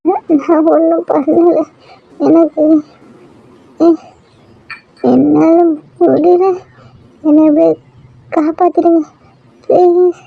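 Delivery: halting, broken, each word a struggle; barely holding on, stammering out each word; faltering dying words. Acted. Dying wife to her weeping husband, halting and broken. Bleeding wife begs to be saved.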